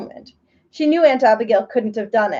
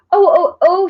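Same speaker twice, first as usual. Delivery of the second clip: breathless high gasps, a little louder; gasping; 7 semitones higher, 5 dB louder than usual. A narrator's reading. Betsy gasping to Aunt Abigail, breathless and overwhelmed. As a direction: gasp it out, overwhelmed. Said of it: "she gasped out".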